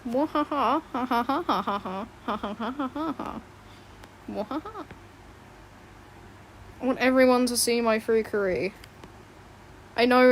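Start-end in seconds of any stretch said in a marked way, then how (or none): 0.1-4.9 evil laugh. Pretend.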